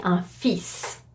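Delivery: French fils ending in a clear S; sounded final S. Shown as a demonstration. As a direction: say fils with the S pronounced. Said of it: "you would have to pronounce the S".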